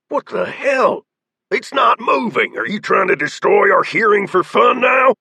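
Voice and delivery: high, loud furious scolding; angry shouting. A narrator's reading. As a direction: chew out the shooter. Leader scolds the shooter.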